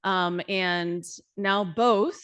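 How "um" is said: ordinary talk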